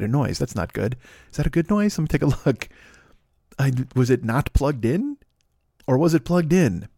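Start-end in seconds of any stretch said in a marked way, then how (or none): none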